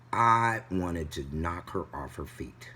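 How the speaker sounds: ordinary talk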